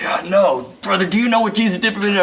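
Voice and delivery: hammed-up fervent choir man; insistent church brother. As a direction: push the question again, exasperated. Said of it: "And he's like".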